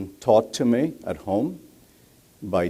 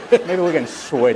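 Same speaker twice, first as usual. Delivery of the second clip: laughing it out, higher and louder; 9 semitones higher, 7 dB louder than usual. Real, not acted. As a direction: speak through a laugh. Joking about mic accent.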